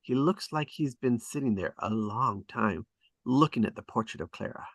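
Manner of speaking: usual speaking voice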